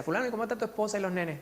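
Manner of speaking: pregunta en broma, aguda y rápida; tomándole el pelo con cariño; broma juguetona. Retold or imitated. Teacher jokes with student. (imitando su propia broma al alumno)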